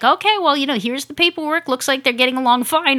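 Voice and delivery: breezy mock of the adoption ladies; mock rescue lady. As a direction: chirp it like nothing's wrong. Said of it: "the lady are like".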